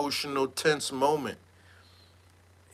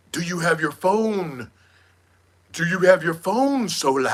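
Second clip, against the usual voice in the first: mock Jaguar demand. Imitating Jaguar demanding Solar's phone. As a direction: demand it in a put-on voice. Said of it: "she's like".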